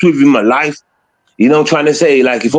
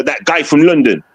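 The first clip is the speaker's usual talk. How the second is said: normal talking voice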